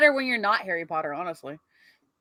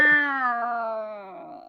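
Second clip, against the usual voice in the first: angry cat voice; 4 dB quieter than usual. Pretend. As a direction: meow like an annoyed cat. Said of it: "I can do a really good angry cat voice".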